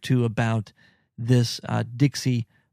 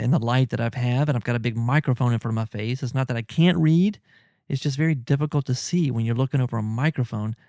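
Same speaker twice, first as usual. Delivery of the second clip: normal talking voice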